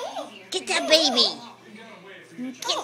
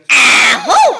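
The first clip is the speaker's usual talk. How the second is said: high loud imitated sneeze ahoo; mock sneeze; 14 dB louder than usual. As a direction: fake a big sneeze. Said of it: "what does Mama say when she sneezes?".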